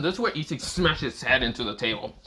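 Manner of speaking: normal talking voice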